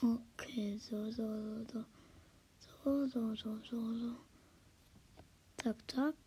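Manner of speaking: hushed whisper, trying not to be heard. Real, not acted. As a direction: whisper so nobody wakes up. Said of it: "Ich muss leise sein".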